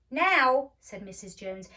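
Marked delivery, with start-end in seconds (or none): 0.1-0.7 loud, firm mother's order; firm command. A narrator's reading. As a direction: end the argument at once. Mother insists.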